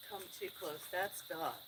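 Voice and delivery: normal talking voice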